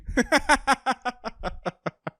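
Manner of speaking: high laughter trailing away in short bursts; drawn-out high giggle; long high laugh. Real, not acted. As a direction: keep cackling high until it peters out. Laughs at his own bad decisions.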